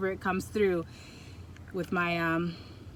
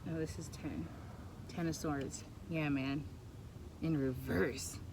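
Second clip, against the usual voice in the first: low murmur; 3 semitones lower, 9 dB quieter than usual. Real, not acted. Reading a drawn card.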